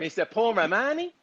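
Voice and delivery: mock Kiwi intro with every line rising; New Zealand accent. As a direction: end "Romani?" on a high question rise. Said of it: "Everything's a question?".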